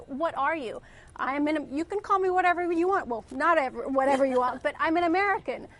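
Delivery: normal talking voice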